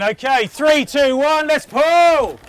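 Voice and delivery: shouting a count. Real, not acted. Calling the count for a rope pull.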